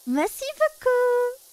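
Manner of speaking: high, delighted French thank-you; mock-flattered thanks. Streamer answering being told she sounds French, mock-flattered.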